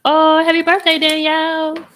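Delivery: high, loud, bright birthday cheer; excited birthday greeting. Real, not acted. Greeting Danielle on her birthday, excited and loud. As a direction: call out happy birthday with a big lift.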